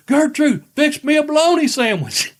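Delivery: high, loud hollered demand; grandfather yelling. Retold or imitated. Grandfather calls grandmother for a sandwich, imitated loud yell. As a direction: imitate grandfather bellowing for food. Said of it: "he'd yell it out again".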